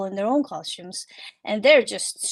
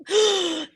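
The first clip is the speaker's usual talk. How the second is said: sharp, high acted gasp; crowd gasp; 9 semitones higher than usual. People watching the bear roll down, shocked gasp. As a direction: gasp like a startled onlooker. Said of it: "they're gasping".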